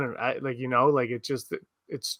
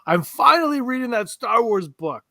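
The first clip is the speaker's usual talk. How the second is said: high-pitched, mock-proud announcement; excited dad imitation; 9 semitones higher, 4 dB louder than usual. Son reading out dad's surprise text about a book, excited.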